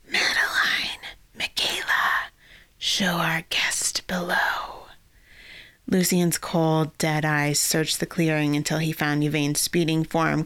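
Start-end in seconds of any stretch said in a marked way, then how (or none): 0.1-4.9 whispered villain voice. Acted. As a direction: hiss it like an undead lich. Lich commands his creatures.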